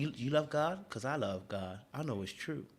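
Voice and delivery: soft, smooth mock suitor; hushed, calm questioning; cool and calm. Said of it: "asking these really cool, calm".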